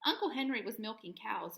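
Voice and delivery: plain reading voice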